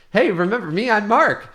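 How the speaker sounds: high, loud, overeager greeting; eager Mark. Acted. Mark greeting Rufus before their match, high and eager. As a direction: pipe up loudly, keen to be remembered.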